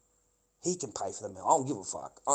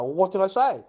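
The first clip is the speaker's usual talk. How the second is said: loud, high-pitched demand; pitched-up, frustrated retort; acted exasperated question; 8 semitones higher, 9 dB louder than usual. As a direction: re-enact pushing back, voice raised. Acts out demanding an answer.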